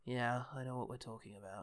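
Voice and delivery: quiet, low, resigned delivery; grim and fatalistic. Retold or imitated. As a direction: say it softly, as if fate is settled. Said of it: "something sort of grim and fatalistic".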